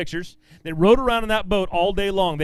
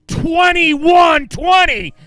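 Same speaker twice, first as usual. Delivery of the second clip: higher, louder, slow stretched-out shout; booming announcement; 10 semitones higher, 9 dB louder, much slower than usual. Real, not acted. Emcee calling out the giant bag weight, booming shout. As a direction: roar the number out to the crowd.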